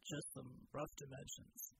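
low, slow, quiet soldier's voice; measured and grim; low gruff soldier. A narrator's reading.

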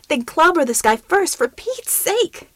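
high, loud exasperated cry; exasperated urging. Acted. Ramona urging Yankee Pride to hit the shapeshifter, high and exasperated. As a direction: push him to act, pitch shooting up.

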